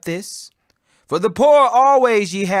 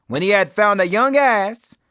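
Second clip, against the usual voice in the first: ordinary delivery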